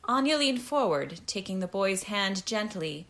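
plain reading voice

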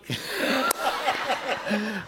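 sudden high-pitched laugh; high laughing burst. Real, not acted. Pastor cracking up at his own story, high laughter. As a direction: let a high laugh break out.

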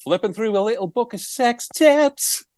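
lightly mock-crooned line.

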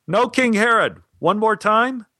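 high, drawn call across a theater; yelling up. Retold or imitated. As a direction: call out to a far-off director. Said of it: "I yelled up to Stein".

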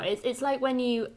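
ordinary talk, nothing marked

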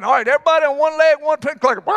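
hammed-up chicken boss firing off orders; excited command. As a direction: blurt the orders in a rush. Chicken leader calls out command.

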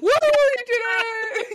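high, loud, joyful shout; excited cheer. Real, not acted. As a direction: cheer them on, thrilled. Cheering friend's success.

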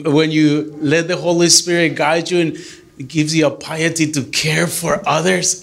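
ordinary delivery

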